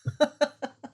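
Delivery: sudden high laugh; high burst of laughter. Real, not acted. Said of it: "how'd you get these wild childs".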